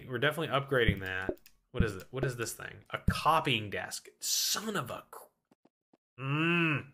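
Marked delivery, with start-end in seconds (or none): 4.2-6.8 stifled curse. Real, not acted. Annoyed at wrong desk.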